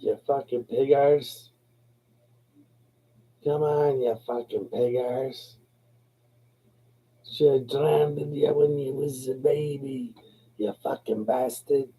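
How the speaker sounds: high-pitched Irish nana's scolding; Irish brogue. Retold or imitated. Nana from Kerry cursing the child she loves, high Irish brogue. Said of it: "like, Irish brogue".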